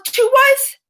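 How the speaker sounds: high, loud, outraged yelp; sudden loud outburst of indignation; indignant shout. Real, not acted. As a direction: shout it out in disbelief. Outraged at prisoners' vaccine priority.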